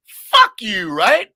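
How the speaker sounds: loud, high yelled insult; shouted insult. Retold or imitated. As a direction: yell it back. Acted comeback in rant.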